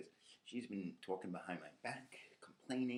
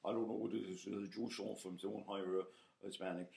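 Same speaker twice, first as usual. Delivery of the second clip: Jackie Mason voice; 3 dB louder than usual. Retold or imitated. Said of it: "he talked just like Jackie Mason".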